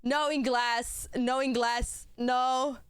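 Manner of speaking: slow mock "no inglés", Spanish accent; fake Spanish speaker. Dodging a refund request by faking a Spanish accent. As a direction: act like you only speak Spanish. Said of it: "no entiendo qué me estás diciendo".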